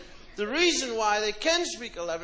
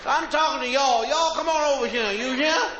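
put-on loud Southern accent; 4 dB louder than usual. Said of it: "Mississippi dude again".

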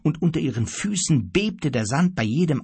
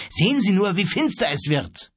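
urgent appeal, pitched up; urging; 7 semitones higher than usual. A narrator's reading. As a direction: press him to hurry. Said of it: "drängte Grief". (drängend)

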